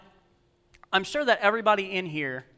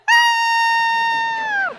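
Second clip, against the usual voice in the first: very high, long, loud scream; hammed-up screaming your head off; imitated terrified scream; 6 dB louder than usual. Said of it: "she just lost it. She's like".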